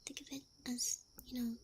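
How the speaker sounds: soft, breathy half-whispered encouragement; reassuring ASMR. Real, not acted. Streamer reassuring listeners about Monday, soft ASMR half-whisper. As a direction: gently soothe in a half-whisper.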